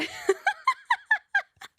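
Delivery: high, helpless giggling fit; long breathless laugh; helpless laughter. Real, not acted. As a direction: crack up completely. Cracks up mid-link.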